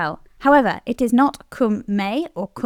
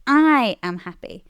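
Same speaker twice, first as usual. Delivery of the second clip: "I" drawn out and punched; big emphatic "I", then ease off; stress on I. Shown as a demonstration. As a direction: make "I" the emphatic word. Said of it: "only really used for emphasis".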